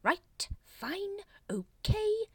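high, strained giving-up; exasperated high-pitched surrender; weary exasperation. A narrator's reading. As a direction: concede word by word, fed up. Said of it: "he said wearily".

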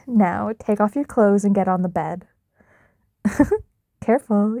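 ordinary delivery

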